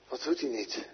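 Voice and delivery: a teasing, hushed wondering aside; preacher's playful whispered question; mock puzzled whisper.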